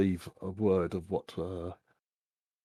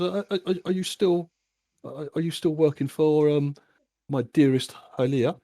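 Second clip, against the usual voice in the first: higher, sugary and teasing throughout; lightly voiced oily gentleman, prying; smarmy host; 6 semitones higher than usual. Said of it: "he goes".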